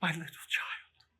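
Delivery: whispered, broken grief; father breaking down over lost child. Acted. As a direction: let the voice collapse into a whisper. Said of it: "He broke down all at once".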